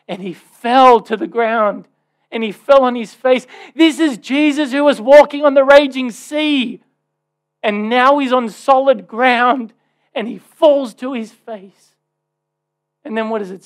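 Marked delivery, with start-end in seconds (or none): 0.1-11.9 louder, higher, building intensity; rising preaching fervor. Real, not acted. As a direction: preach it with mounting force. Preaching climax on Gethsemane.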